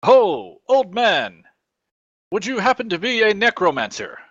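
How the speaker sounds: called-out greeting. Acted. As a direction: call out theatrically to a stranger. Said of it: "I shout out".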